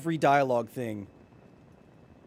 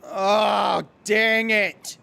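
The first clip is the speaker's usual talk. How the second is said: loud exasperated yell; frustrated shout; 5 semitones higher, 7 dB louder, much slower than usual. Real, not acted. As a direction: shout it, fed up. Frustrated with controls.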